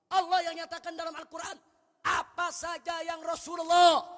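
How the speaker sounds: high, loud preaching shout; khotbah berapi-api. Real, not acted. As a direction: thunder the challenge at the congregation. Preaches at full force. (khotbah lantang dengan nada tinggi)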